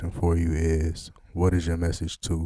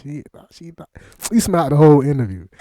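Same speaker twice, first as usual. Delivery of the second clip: laughing teasingly; 7 semitones higher, 10 dB louder, faster than usual. Real, not acted. Interviewer laughs while teasing the guest. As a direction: laugh through the tease. Teasing her about smiling.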